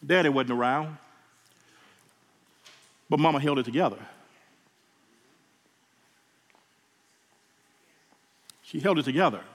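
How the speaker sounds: holding back tears over mama; getting emotional. Real, not acted. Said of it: "Excuse me, I'm getting emotional".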